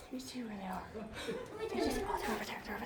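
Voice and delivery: soft breathy whisper while searching. Real, not acted. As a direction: whisper so as not to disturb. Whispering near animal enclosure.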